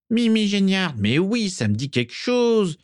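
gloating, pitched well up; nasty smile. A narrator's reading. Lazare delighted to recognise Mimi, nasty smile. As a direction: say it through a mean smile. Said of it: "avec un sourire mauvais".

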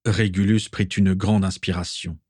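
usual speaking voice

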